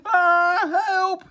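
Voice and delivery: high, loud frightened cry; wail. Acted. Earwig wailing for help as he slides, high and loud. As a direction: cry out as you slip. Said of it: "he wailed".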